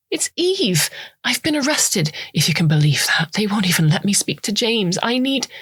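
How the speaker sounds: high, hurried voice of a woman in trouble; anxious woman. Acted. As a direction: push through the news, anxious. Eve explains her arrest.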